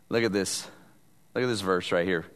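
quiet aside. Real, not acted. Preacher quietly pointing congregation to the verse. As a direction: drop to a low murmur.

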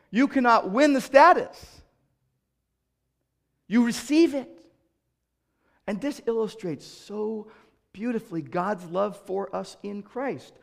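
ordinary talk, nothing marked

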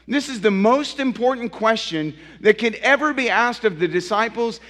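rising pulpit fervour; full-force preaching. Acted. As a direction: preach it out to the congregation.

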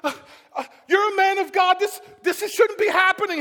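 high, loud disbelief; distraught protest. Acted. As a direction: plead that this is all wrong.

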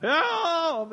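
high, loud mock yell; retold cry for help. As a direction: call out like a scared kid. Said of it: "cried out for help".

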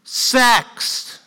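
blurted out high and loud; dramatic one-word intro. Shown as a demonstration. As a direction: punch it out to grab attention. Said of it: "So here's the introduction".

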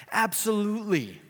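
ringing, high emphatic absolutely; emphatic yes. Real, not acted. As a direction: land it with conviction. Preacher answers his own question.

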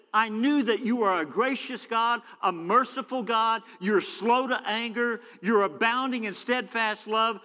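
high-pitched, heated complaint; Jonah getting worked up. Acted. Jonah complains to God about his mercy, heated and high. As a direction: throw God's kindness back as an accusation. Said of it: "Jonah's getting it on here".

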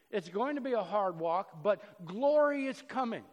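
ordinary delivery

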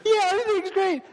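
high-pitched ironic answer; squeaky sarcasm. Retold or imitated. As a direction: squeak it out still rattled. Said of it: "It's like".

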